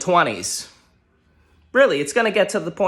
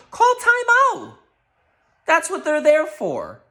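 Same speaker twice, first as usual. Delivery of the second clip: much higher, slow, drawn-out yell of Call timeout; exasperated yell; 12 semitones higher, slower than usual. Real, not acted. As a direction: plead with the coach at the top of your voice. Fan yelling at coach.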